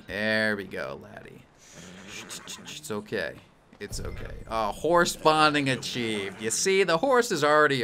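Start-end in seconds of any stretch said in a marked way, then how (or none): 0.1-3.3 soothing a horse. Real, not acted. Calming a spooked horse. 3.8-4.3 whispered softly to a frightened horse; breathy whispered reassurance. Real, not acted. Calming a spooked horse.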